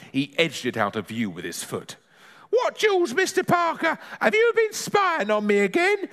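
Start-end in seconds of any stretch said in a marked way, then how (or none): 2.5-6.1 very high granny voice; high-pitched indignant questioning; affronted granny. Acted. Granny feigns innocence to Parker.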